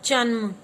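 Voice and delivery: जन्म said loud with a clear half म; teacher models जन्म for class; model pronunciation. Shown as a demonstration. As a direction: hit जन्म with the half letter audible. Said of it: "अब ये क्या है next?".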